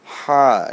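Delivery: loud "হা" from far inside the throat; deep throaty HA. Shown as a demonstration. Teacher pointing out the letter's origin, deep throaty "হা". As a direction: force "হা" up from mid-throat. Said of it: "এটা অনেক ভিতর থেকে আসছে".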